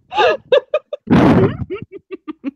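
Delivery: high squealing giggle; a delighted high-pitched giggle fit. Acted. Fox squeals with laughter at the wedding.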